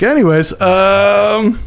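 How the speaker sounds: high-pitched, drawn-out "um" to change the subject; awkward dodge. Retold or imitated. Awkward dodge after being told off.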